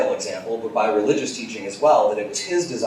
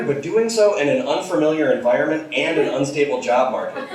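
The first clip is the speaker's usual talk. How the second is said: put-on exasperated girlfriend; 6 semitones higher than usual. Girl scolds boyfriend.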